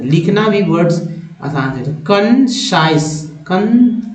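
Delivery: ordinary delivery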